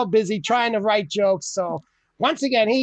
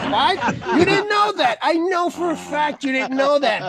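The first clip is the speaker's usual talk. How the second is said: indignant outburst; 7 semitones higher than usual. Real, not acted. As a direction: pitch way up in disbelief, insisting. Indignant retort to know-it-alls.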